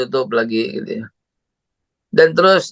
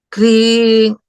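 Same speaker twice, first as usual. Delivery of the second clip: phone ring; 9 semitones higher, 5 dB louder than usual. Retold or imitated.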